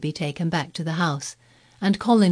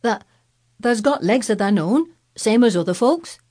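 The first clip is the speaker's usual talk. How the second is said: higher, louder Yorkshire lad; hearty Yorkshire country boy; Yorkshire accent; 5 semitones higher, 6 dB louder than usual. Acted. Dickon voiced, Yorkshire.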